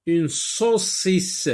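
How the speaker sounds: "une saucisse" drawn out slowly; slow word read. Shown as a demonstration. Teacher reading "saucisse" slowly to children. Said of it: "Je lis des mots".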